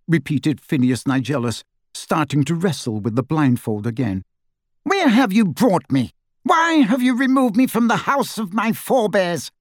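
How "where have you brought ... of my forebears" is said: high, pompous demand; affronted hauteur in a high voice; snide grandee, indignant. A narrator's reading. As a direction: demand an explanation imperiously. Snide portrait Phineas demands answers.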